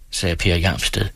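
plain reading voice

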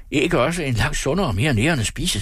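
high, lilting self-satisfied claim; pleased with himself. A narrator's reading. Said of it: "trippede tilfreds ind i stuen". (selvtilfreds og syngende)

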